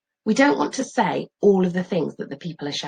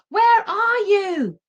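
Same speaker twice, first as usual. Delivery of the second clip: calling out; 4 dB louder, much slower than usual. Acted. Calling for lost dog.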